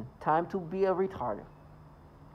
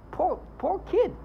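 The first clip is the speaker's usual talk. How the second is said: high, slow pitying cry; 13 semitones higher, slower than usual. Real, not acted. Speaker pitying the coerced girl, high and slow.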